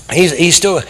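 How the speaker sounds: ordinary talk, nothing marked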